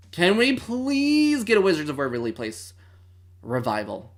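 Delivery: loud, high-pitched begging; exaggerated pleading. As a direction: beg dramatically, swooping up on please. Begging for a show revival.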